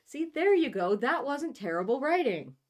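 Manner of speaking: high, loud cheering praise; mock praise. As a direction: cheer on a struggling writer.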